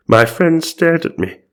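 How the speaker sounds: high, hammed-up narrator voice; theatrical mock wonder; dramatic retake. A narrator's reading. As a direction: pitch it up like an astonished storyteller. Retake in the character's voice.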